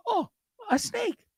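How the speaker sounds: high, breezy "oh, a snake, wow" as if it's nothing; mock nonchalant. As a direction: play it cool but way up high. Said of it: "I was just like".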